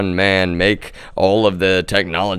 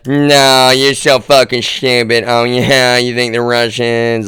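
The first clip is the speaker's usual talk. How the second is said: loud, high mocking yell at doubters; mocking shout; 5 semitones higher, 6 dB louder, slower than usual. Mocking shout at people who doubt him. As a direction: sneer it out loud like an angry troll.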